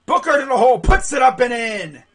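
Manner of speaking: loud, excited basket call; live play-by-play. Real, not acted. Commentator calls Booker scoring, loud excited play-by-play. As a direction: punch out the drive and score.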